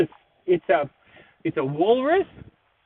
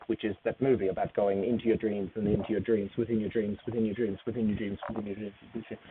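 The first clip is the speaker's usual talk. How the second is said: each repeat quieter, fading away; fading echo; 8 semitones lower, 8 dB quieter, faster than usual. Pretend. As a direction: let it fade like an echo. Echoing dream repetitions fading.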